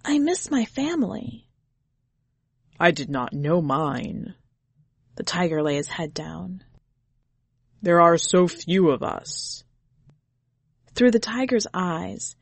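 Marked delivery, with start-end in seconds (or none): 0.0-1.5 put-on high little boy voice; a sad small child. A narrator's reading. Boy tells the tiger he misses home, high and sad. 2.8-9.6 lightly lowered tiger voice. A narrator's reading.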